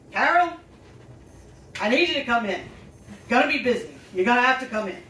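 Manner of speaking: loud, firm boss on the phone; insistent boss. Retold or imitated. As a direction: imitate a boss who won't take no. Gruff boss answers her on phone.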